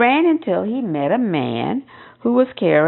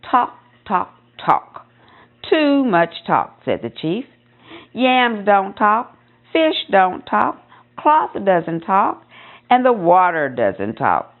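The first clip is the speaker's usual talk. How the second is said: slow, deliberate chief laying it down; a measured, slow scolding chief; stern chief; slower than usual. Acted. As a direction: spell it out slowly like a weary chief.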